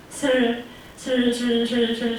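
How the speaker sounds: 슬 repeated with the tongue curled; repeated retroflex 슬; retroflex drill. Shown as a demonstration. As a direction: drill 슬 over and over. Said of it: "슬 하면은 혀가 딱 말리잖아요".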